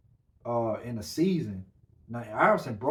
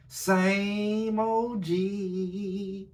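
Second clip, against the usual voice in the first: slow, stretched-out crooning drawl, a bit higher; 6 semitones higher, much slower than usual. Retold or imitated. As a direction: draw every word out like a slow love song. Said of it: "y'all heard what [name] said".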